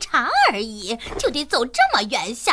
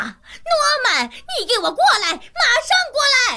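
higher, louder scolding call; angry calling; 9 semitones higher, 3 dB louder than usual. Acted. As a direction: summon the naughty boy now. Mother angrily summons her son.

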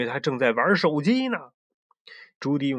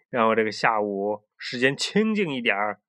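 ordinary delivery

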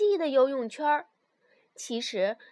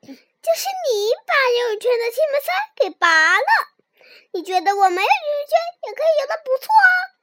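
angry; 11 semitones higher, 12 dB louder, faster than usual. Acted. Angry accusation continues.

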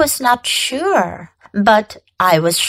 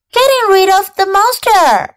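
high-pitched, mildly shouted reply; yelling back; 8 semitones higher, 3 dB louder than usual. A narrator's reading. Child answers mom about the monster, mock yelling back. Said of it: "I yelled back".

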